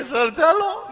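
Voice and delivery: high tearful mock wail, imitating the weeping teacher; crying teacher. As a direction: whimper it like a teacher close to tears. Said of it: "teacher રોતા રોતા આવ્યો". (રડતી શિક્ષિકાનો ઊંચો, રોતલ નકલી અવાજ)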